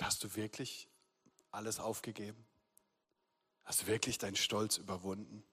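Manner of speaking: the small still voice in his head, spoken softly; quiet inner voice. Retold or imitated. God speaking quietly to the pastor in his head, hushed. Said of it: "diese kleine Stimme in meinem Kopf".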